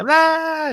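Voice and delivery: very high hammed-up kid shriek; child's mock yell. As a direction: squeal it like a toddler screaming. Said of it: "el niño grita".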